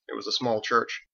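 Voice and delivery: ordinary delivery, nothing marked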